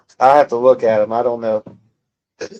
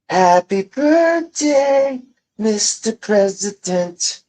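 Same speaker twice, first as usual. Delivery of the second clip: high, slow, breathy sing-song to the president; sultry birthday serenade; 8 semitones higher, much slower than usual. Pretend. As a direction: coo it like a seductive birthday song. Marilyn-style breathy birthday song.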